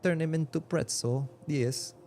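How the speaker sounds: put-on halting foreign accent, hunting for the English words; foreign-accented dad. Dad teaching his kid jujitsu, thick foreign accent. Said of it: "if we wanna be lore accurate".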